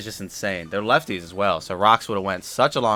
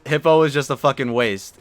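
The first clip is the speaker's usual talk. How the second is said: frustrated; 4 semitones higher, 7 dB louder than usual. Real, not acted. Frustrated after losing a Pokemon.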